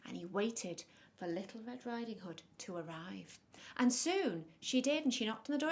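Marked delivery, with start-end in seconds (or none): none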